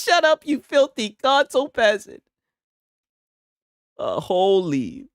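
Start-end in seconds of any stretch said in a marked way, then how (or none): 0.0-2.2 higher, scornful put-down, lightly hammed; mock snobby insult. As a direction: sneer it down at a console player, playfully. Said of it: "My dude says".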